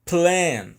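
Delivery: "Plan" hit high and loud, then falling; stressed at the start. Shown as a demonstration. Teacher demonstrates to students stress at the beginning, stressed at the start. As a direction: punch the start of "Plan" hard. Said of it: "No começo".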